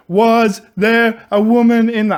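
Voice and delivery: slow, loud exaggerated enunciation; mock over-slow questioning. Retold scene, cop over-enunciates slowly. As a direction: drag each word out like to a deaf man. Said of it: "He's like".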